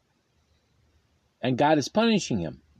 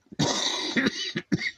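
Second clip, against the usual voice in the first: short dry cough; a single sharp cough; 4 dB quieter than usual. Real, not acted. Cough during the reading.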